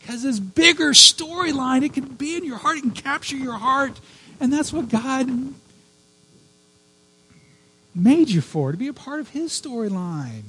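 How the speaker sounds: high, choked-up, emotional plea; tearful preaching. Real, not acted. Preacher to congregation, still tearful and high. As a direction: keep the tears in the voice.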